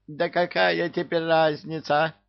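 high shrill squeal, thin timbre. Acted. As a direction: squeal it out in panic. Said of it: "верещал профессор". (визгливо верещит)

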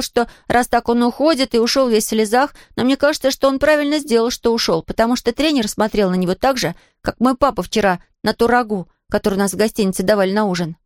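usual speaking voice

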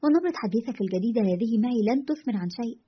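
plain reading voice

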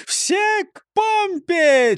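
high, loud, slow command; shouting. Acted. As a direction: call the crew to the pump. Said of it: "крикнул Нисы Чих". (громкий крик)

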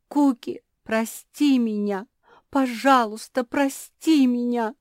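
high, loud, drawn-out plea; desperate begging. A narrator's reading. Аманда kneels before the dragon, begging desperately. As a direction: beg forgiveness with all your heart.

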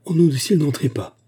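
high desperate warning; urgent cry. A narrator's reading. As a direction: beg them loudly to stop. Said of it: "s'écria-t-il".

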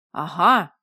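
loud, low triumphant exclamation; crying out. A narrator's reading. Dragon catching the heroine out, loud low cry. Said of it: "возопил дракон".

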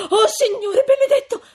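high, loud tearful outcry; shrieked exclamation near tears; astonished cry. A narrator's reading. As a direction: cry out in shock, voice soaring. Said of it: "guarda più attenta e grida".

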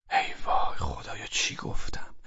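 slow, low, whispered dismay; horrified whisper. Acted. Husband regrets insulting the caller, horrified whisper. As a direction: whisper it to yourself, appalled. Regrets outburst after hanging up.

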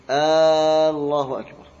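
آه، الله أكبر with a loud, stretched آه before الله; drawn-out hamza on Allah. Shown as a demonstration. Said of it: "لما يقول". (مد الهمزة بصوت عالٍ: آه، الله أكبر)

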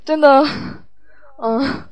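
ordinary talk

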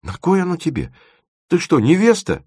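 high, swooping puzzled questions; naively amazed. A narrator's reading. Timofeev questions Dima about the dress, naively amazed. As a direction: wonder at it innocently. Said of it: "наивно изумился Тимофеев".

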